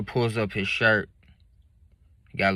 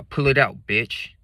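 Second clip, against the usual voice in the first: angry; 4 semitones higher, 5 dB louder, slower than usual. Retold or imitated. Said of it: "'Cause now I'm mad".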